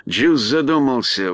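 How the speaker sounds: ordinary delivery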